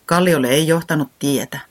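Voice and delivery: plain reading voice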